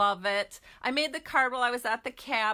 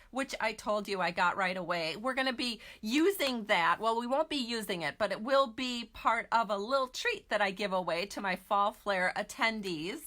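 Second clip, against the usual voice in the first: ordinary talk, nothing marked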